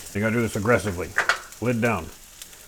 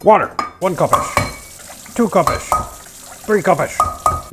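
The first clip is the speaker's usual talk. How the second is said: slow, louder, higher counting; drawn-out counting; 7 semitones higher, 5 dB louder, slower than usual. Real, not acted. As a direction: stretch each cupish playfully. Slow playful measuring.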